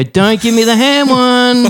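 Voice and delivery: mildly hammed-up exasperated plea; exasperated customer. Customer at the window to the staff, exasperated. Acted exchange at the drive-through window.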